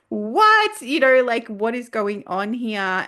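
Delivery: high, loud, incredulous what?; exasperated disbelief. Retold or imitated. Staff resigning again, retold incredulous outburst. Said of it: "I was so angry".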